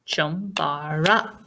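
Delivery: Chumbara said slowly, syllable by syllable; slow syllable count of Chumbara; syllables spoken slowly. Shown as a demonstration. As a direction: spell out Chumbara's three beats slowly. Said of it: "How many syllables?".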